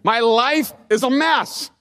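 he throws up his hands at God, slowing on mess; exasperated complaint. Retold or imitated. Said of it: "In other words".

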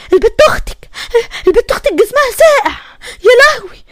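high, frightened, broken-up report; tearful panic. Acted. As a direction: blurt it out in rising dread. Mother panics over cold daughter. (هلع وصوت قرب يعيط)

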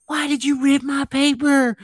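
high, loud, drawn-out mock wail; child's meltdown. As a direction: imitate a six-year-old wailing in a complete breakdown. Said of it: "Like, complete breakdown".

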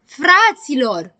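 yelling. Acted. Grăbilă calls his friends to protest, yelling. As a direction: stretch the call out slowly and loudly. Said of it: "țipă Grăbilă".

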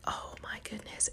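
breathy whispered dismay; exasperated whisper. Retold or imitated. Mom whispering to herself on seeing the paper forgotten. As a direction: whisper it under your breath in disbelief.

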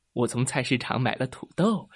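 ordinary delivery, nothing marked

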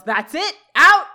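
loud burst on out, mock shouting; retold yell. Sean throws Topanga out, shouting. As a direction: snap it out, building to a yell on out. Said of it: "And Sean yells".

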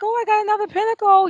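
high, fast, giddy squeal; excited. Retold or imitated. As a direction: blurt it out thrilled and quick. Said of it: "I was excited".

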